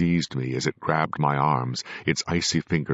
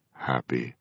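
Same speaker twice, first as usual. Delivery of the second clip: witless voice; 2 semitones lower, 4 dB quieter than usual. A narrator's reading. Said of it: "its witless voice".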